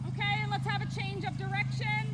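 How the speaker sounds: brisk projected riding commands. Real, not acted. Instructor calling across arena.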